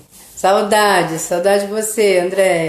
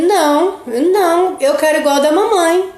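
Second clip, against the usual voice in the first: put-on higher, a small boy refusing his plate; little son's voice; 7 semitones higher than usual. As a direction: mimic the child insisting on mum's food. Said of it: "ele olhou pra mim e falou assim". (voz de criança imitada, mais aguda)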